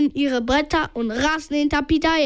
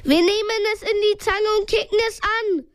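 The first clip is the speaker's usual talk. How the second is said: raised, higher-pitched call; 7 semitones higher than usual. A narrator's reading. Justus to his friends, attacking the creature, calling out. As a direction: call it out to the others in a high voice. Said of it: "rief Justus".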